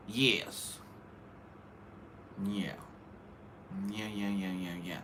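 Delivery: very soft repeated yeah; muttered. Real, not acted. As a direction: mumble it under your breath. Muttered agreement.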